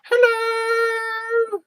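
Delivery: loud, very high falsetto hello; silly high greeting. Pretend. Messing about with Ganesh, who isn't impressed, silly falsetto hello. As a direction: squeal a long playful hello.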